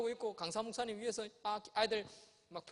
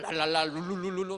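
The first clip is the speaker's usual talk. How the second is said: mock tongues babbling; 6 dB louder than usual. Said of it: "방언하기 시작하죠".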